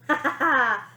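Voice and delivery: high, loud fake laugh; a chuckle that finds nothing funny; forced chuckle. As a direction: mirthless stage chuckle. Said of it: "the T V star chuckled to herself".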